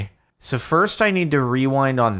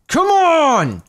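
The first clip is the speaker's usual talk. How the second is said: high, loud drawn-out yell; long, high cry of impatience; frustrated shout; 6 dB louder than usual. Real, not acted. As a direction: holler it at the game, exasperated. Urging the game on in frustration.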